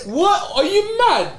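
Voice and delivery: high, loud outburst breaking into laughter; loud disbelief. Real, not acted. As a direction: react as if the pick is outrageous. Astonished at friend's pick.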